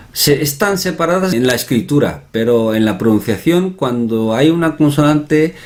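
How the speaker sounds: ordinary delivery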